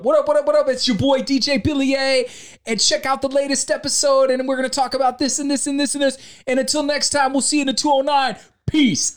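high-pitched, energetic hype-man patter; hyped podcast promo. Retold or imitated. Host filming an Instagram podcast promo, hyped high voice. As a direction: sell it high and lively to the phone. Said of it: "I'm doing a video for the".

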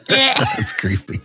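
slow drawn-out creeped-out high voice; slow disgusted "that's creepy"; grossed out. Real, not acted. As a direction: recoil from the idea. Mock disgust at daddy.

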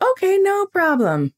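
high, drawn-out singsong compliance; over-agreeable. Retold or imitated. Mock-agreeable reply to unfriendly clerk.

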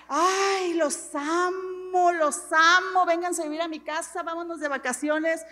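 drawn-out, sugary mock affection; hammed-up adoring welcome; mock gushing love. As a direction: gush over them with exaggerated love, stretching the words. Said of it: "No crean que la gente yo la amaba y decía".

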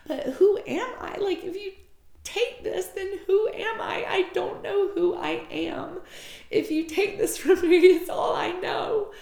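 high, crying plea; tearful protest. Retold or imitated. Her reliving the fear of losing herself, tearful protest. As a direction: plead through tears, voice climbing high. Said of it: "and I start crying".

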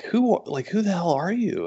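a lightly voiced startled editor; Mark, amazed. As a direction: Mark demanding to know the newcomer. Quoting Mark pulling him aside.

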